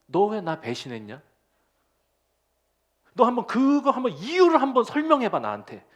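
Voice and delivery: a hint of a stern demanding master; imagined rebuke. Preacher imagining Jesus scolding Peter, mock stern. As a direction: press him for an explanation, half-acted. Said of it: "이렇게 질책하셨다면".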